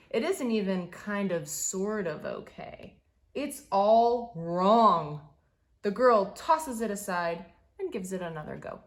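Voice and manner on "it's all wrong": slow and louder, each word weighed; heavy dramatic letdown; drawn-out dismay. A narrator's reading. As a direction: lean hard on all wrong. Dramatic verdict in story.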